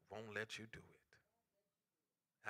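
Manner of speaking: a slow, near-silent resolve; hushed. Acted. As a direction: let the words fall almost silent. Whispered drop after "but love.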